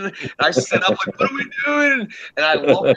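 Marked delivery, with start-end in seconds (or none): 1.2-2.1 mock panicked, pitch leaping up at the end; high-pitched startled disbelief, played up; retold alarm. Telling his friends how he woke up tripping, mock panic.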